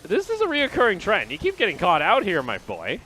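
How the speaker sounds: loud, high play-by-play call; excited casting. Real, not acted. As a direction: call it out with alarm. Casting a death.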